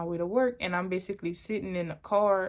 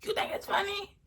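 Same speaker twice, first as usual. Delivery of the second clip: imitated indignant challenge; 12 semitones higher than usual. Boyfriend angry at her laughing, imitated high indignant challenge.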